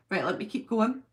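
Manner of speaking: ordinary talk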